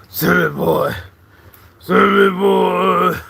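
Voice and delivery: high, slow stretched-out call of the name; drawn-out call. Acted. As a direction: sing the name out long and loud.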